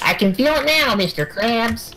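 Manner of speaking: high, cartoonish sponge voice; squeaky SpongeBob answering his boss. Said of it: "really good SpongeBob voice".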